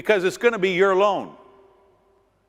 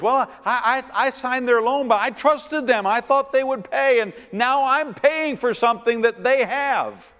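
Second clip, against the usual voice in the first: mock pleading co-signer, higher voice; whining co-signer; 9 semitones higher than usual. Preacher mimics a regretful co-signer, higher whining. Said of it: "horror stories".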